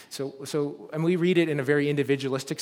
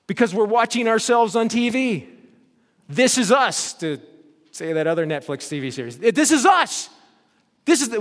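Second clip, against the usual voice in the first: louder, higher scripture proclamation; forceful preaching; 8 semitones higher, 8 dB louder than usual. Acted. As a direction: proclaim it to the whole congregation.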